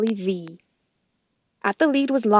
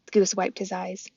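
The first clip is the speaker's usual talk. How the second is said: ordinary delivery, nothing marked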